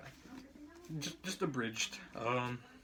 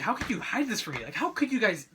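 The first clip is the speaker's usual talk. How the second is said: higher and louder, an imitated betrayed outburst; retold angry outburst; 5 semitones higher, 5 dB louder than usual. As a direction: throw the accusation like a hurt son. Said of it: "he's extremely upset".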